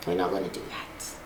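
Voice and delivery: fast, breathy whisper of refusal; 말을 낮춰 속삭이듯 빠르게. Real, not acted.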